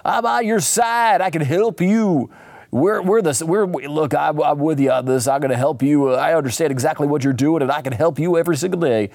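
oversell yourself as the helpful guy; stretched-out glad-handing schmoozer, easing into patter; Harry Ellis. Retold or imitated. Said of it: "the guy was like".

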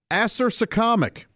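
acersocomic said AS-er-suh-COM-ic, short o in COM; first pronunciation. Shown as a demonstration. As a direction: say acersocomic with a stressed AS and a COM like comma. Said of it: "I'm probably pronouncing it wrong".

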